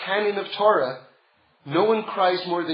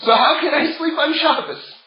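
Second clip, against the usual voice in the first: higher, louder mock exclamation; exclaiming rebbe; 7 semitones higher, 6 dB louder than usual. Sanzer Rebbe asking how a king could sleep on Shabbos, loud mock exclaim. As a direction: toss the question out like an amazed rebbe.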